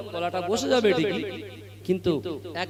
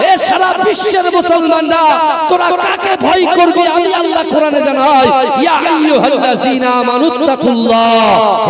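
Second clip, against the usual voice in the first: high, loud, shouted sermon cry; fiery preaching; 9 semitones higher, 12 dB louder than usual. Acted. Preacher rousing young Muslims to fear Allah, shouting. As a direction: thunder it at the crowd like a revival call.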